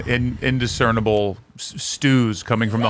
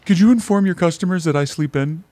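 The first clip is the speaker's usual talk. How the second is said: prissy polite; 7 semitones higher than usual. Pretend. Polite complaint to dealer.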